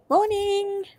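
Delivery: high sing-song hello; cheerful greeting. Real, not acted. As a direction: greet brightly.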